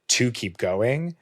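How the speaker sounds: ordinary talk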